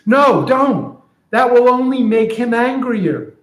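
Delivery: donkey begging a friend not to call; anxious donkey. Retold or imitated. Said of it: "So here's what I'm imagining".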